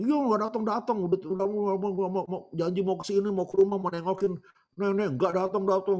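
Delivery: high, put-on old woman's nagging; hammed-up granny rant, words tumbling and repeated; grumbling granny. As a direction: sputter complaints in a high granny voice. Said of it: "sudah mulai ngomel".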